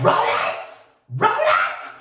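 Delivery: shocked, can't believe it; outraged disbelief at rodents; incredulous. Retold or imitated.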